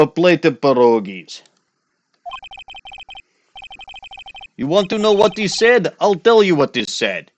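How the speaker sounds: light mock Russian accent, scornful; a hint of a Russian heavy sneering. Russian guy dismisses Cadaverini to Phoenix, mock Russian accent. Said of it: "the proper accent".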